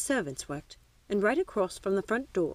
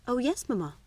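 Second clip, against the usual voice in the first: higher, lightly voiced little girl; dutiful young daughter; 5 semitones higher than usual. A narrator's reading. Daughter answering mamma's questions, dutiful little girl.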